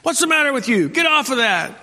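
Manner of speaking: high-pitched imitated yell at the kids; mock yell. Preacher imitating a father yelling at his children, high mock yell. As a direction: shout it like the frazzled dad in the airport. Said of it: "I could hear somebody screaming down there".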